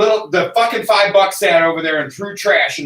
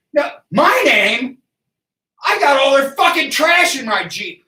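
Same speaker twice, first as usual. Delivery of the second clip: higher, louder, outraged shout at the cop; retold angry shout; 9 semitones higher, 4 dB louder, slower than usual. Man shouting back at the cop over his name, outraged. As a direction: yell back in disbelief. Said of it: "reply to the cop".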